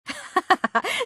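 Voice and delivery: high-pitched giggling laugh; light laugh, pitched well up; acted laugh. Teasing someone as too serious, laughing.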